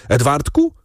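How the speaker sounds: high-pitched calling, held back in volume; crying out. A narrator's reading. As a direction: call the boy's name in alarm. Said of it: "krzycząc".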